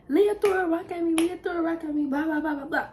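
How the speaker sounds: high, whiny imitation of a crying boy; mock crying kid. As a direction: whine it like a kid tattling through tears. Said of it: "My cousin come in crying".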